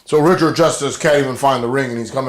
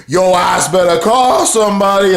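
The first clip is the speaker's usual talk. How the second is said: high-pitched, singsong jeer; mock taunt; 7 semitones higher, 4 dB louder than usual. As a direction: taunt him, pitched well up. Cornette and Moose taunting EC3.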